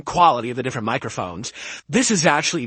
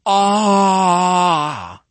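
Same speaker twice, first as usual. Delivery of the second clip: loud drawn-out cry into the mic; showing off the mic with a loud held cry; sound effect demo; 3 semitones higher, 5 dB louder than usual. Shown as a demonstration. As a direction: belt out a test cry for the new microphone. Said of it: "Just listen to this".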